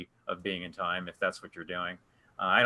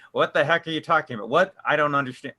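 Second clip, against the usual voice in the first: quick, raised, incredulous questioning; baffled student; 5 semitones higher, 5 dB louder, faster than usual. Retold or imitated. To the group, imitating a confused student's baffled question. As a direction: mimic a lost student who can't follow. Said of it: "I think you were asking".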